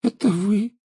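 put-on high, slow sulky ghost girl; eerie ghost child. A narrator's reading. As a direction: drag it out, glum and ghostly. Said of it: "хмуро произнёс призрак".

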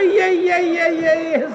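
high, slow tutting; fussing tut-tut. Acted. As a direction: cluck like a fretting old man. Uncle fussing after her.

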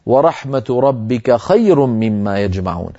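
slow, melodic recitation; Quran recitation. Acted. Teacher quotes the verse in recitation tone.

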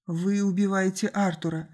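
ordinary delivery, nothing marked

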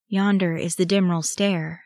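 ordinary delivery

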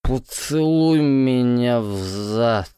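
put-on slow slurred drunk reading; drunk slurring. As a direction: slur it, tongue-tied. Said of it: "читает он заплетающимся языком".

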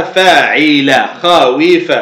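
فاعل slowly as fa-'i-la, then خاويف as kha-wi-fa; pattern demonstration. Shown as a demonstration. Teacher showing the pattern of the verb, خاويف slowly as kha-wi-fa. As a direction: sound out فاعل and خاويف by syllable. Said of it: "خاف وزنها فاعل".

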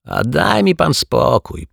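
high, drawn-out exasperated brush-off; dismissive snort. A narrator's reading. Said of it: "prychnął Martwiak". (wysokie, przeciągłe prychnięcie)

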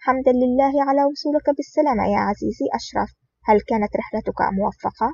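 ordinary delivery

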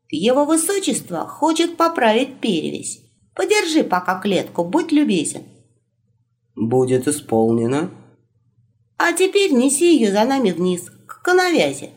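high, brisk orders to a servant; commanding wolf voice. Acted.

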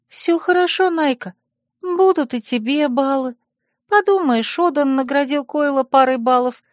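high-pitched, pleading reassurance; anxiously soothing friend. Acted. Worried friend calms the agitated heroine, reassuringly. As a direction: talk her down in a high, careful voice.

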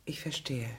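unvoiced, hurt acceptance; whispered resignation. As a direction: let it out on the breath, wounded. (geflüsterte, gekränkte Einsicht)

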